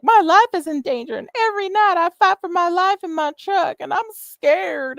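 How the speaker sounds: high, whiny, hammed-up plea for pity; mock her melodrama, slowing on scared; laying it on thick. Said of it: "laying it on thick and being like".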